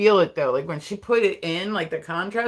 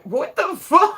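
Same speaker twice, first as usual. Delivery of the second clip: high, loud disbelieving yelp; shocked outburst; 11 semitones higher, 6 dB louder, slower than usual. Retold or imitated. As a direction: shriek it in total disbelief. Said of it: "I'm like".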